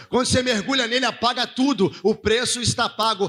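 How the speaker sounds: ringing sermon building to a drawn-out shout; impassioned preaching. Real, not acted. Preacher proclaiming forgiveness to the congregation, building fervour.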